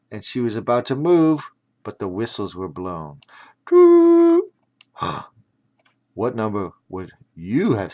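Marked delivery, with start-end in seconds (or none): 3.6-4.4 sharp high gasp; a startled high intake of breath. Acted. Narrator gasps as the whistles blow, a high sharp gasp.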